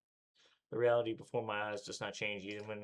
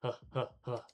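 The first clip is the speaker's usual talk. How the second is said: panting; 3 semitones higher than usual. Acted. Exhausted panting.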